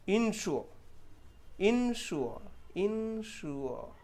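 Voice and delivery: "इंश्योर" drawn out slowly, sh then u-o, no r; correct pronunciation. Shown as a demonstration. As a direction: stretch each syllable like a teacher. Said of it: "एस को हमने शॉ पढ़ा". (धीमा, साफ़ उच्चारण)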